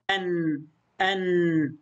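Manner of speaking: أن as "an", closing on a light held n; fatha tanween. Shown as a demonstration. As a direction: repeat أن slowly, nun lingering. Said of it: "يعني كأنه مقطع ساكن".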